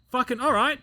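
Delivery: high, exasperated retold reply; flustered compliance. Flustered trainee to shouting instructor, exasperated.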